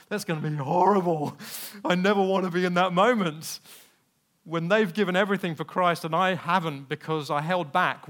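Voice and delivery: loud, aghast inner protest; appalled outburst. Retold or imitated. Picturing himself before the martyrs, appalled and loud. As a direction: blurt out the dread, pushing the volume.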